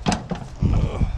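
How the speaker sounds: strained grunt from kneeling down; effort grunt. Real, not acted. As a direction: grunt as the knees give out. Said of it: "I'm grunting, my knees are hurting".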